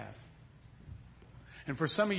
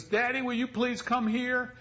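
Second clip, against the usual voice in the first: put-on higher, slow little-boy plea; child calling; 8 semitones higher than usual. Young son calling his daddy, higher and slow. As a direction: call sweetly like a six-year-old.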